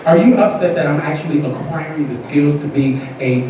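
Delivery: low, unhurried, cool comeback; composed mock apology. Student council member answers a hater, low and composed. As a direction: answer the hater softly with measured poise. Models calm comeback to rant.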